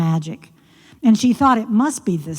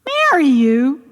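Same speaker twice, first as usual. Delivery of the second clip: shocked princess; 6 semitones higher, 4 dB louder than usual. Acted. Daughter shocked by proposal.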